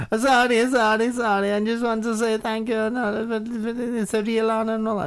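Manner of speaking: put-on high squeaky young boy voice, eager and loud; squeaky kid gamer. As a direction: play an overexcited twelve-year-old fan. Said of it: "it's fucking screeching through my ears".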